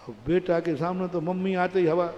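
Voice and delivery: usual speaking voice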